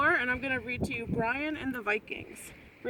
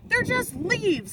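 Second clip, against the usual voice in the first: snorted; 7 semitones higher, slower than usual. A narrator's reading. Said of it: "snorted the Vikings".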